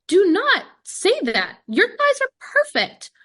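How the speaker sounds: a high, loud, slow scolding of her self-criticism; emphatic insistence. Retold or imitated. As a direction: insist on every word, slowly. Coach scolding her self-talk.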